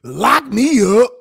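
mock desperate plea. Co-host joining the plea to the judge, loud mock begging. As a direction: belt it out in playful despair.

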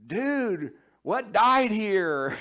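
high, loud, slow dazed surfer-dude voice; loud drawn-out dude voice; risen Lazarus. Acted. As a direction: play Lazarus waking up baffled by the stink. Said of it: "he looks around and said".

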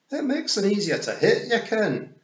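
high, loud Scots pictsie; cocky little Scots fighter, pitched high; Rob Anybody. A narrator's reading. Rob Anybody explaining his fighting tactics, high Scots pictsie voice. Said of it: "as Rob Anybody said".

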